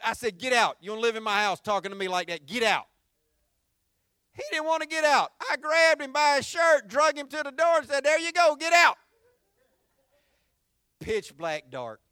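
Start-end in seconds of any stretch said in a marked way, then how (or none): 0.4-2.9 angry order. Retold or imitated. Retold father ordering son out. 8.1-9.0 firm dismissal. Retold or imitated. Pushing son out the door.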